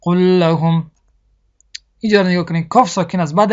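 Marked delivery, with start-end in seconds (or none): 0.0-0.9 قل لهم with the lam doubled and slow; geminated lam. Shown as a demonstration. Teacher models Quran recitation doubling the lam.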